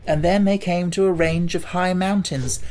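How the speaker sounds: usual speaking voice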